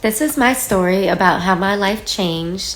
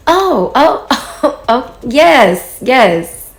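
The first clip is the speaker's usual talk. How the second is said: higher, acted-out "oh, now I get it"; mock recognition; 5 semitones higher, much slower than usual. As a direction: play sudden recognition, pitched up. Acting out sudden recognition in retold chat.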